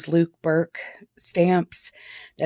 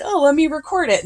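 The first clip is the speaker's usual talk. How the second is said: mock own voice; 10 semitones higher, faster than usual. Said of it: "I'm like".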